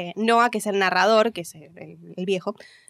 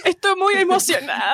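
higher, laughing joke about being moved; 10 semitones higher than usual. Real, not acted. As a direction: laugh through a fake tearful claim. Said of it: "pensé que ibas a llorar". (riendo, más agudo, falsa emoción)

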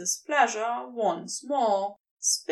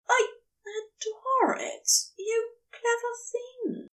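much higher, fond and admiring; higher Aziraphale, adoring; delighted Aziraphale; 10 semitones higher than usual. Acted. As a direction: praise him high and delighted.

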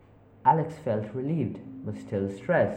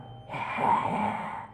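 macabre laugh. Acted. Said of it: "voices laughed in a macabre".

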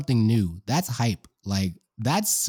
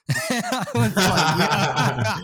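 higher, drawn-out words through laughter; laughing; 9 semitones higher, much slower than usual. Real, not acted. Podcaster retelling a subtitles joke to co-hosts, laughing. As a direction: talk while cracking up at your own story.

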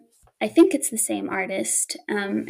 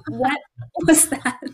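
startled; 5 semitones higher than usual. Retold or imitated. Startled by talking gargoyle.

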